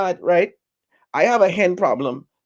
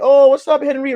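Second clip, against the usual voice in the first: high excited greeting; 9 semitones higher, 5 dB louder than usual. Retold or imitated. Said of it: "they're like".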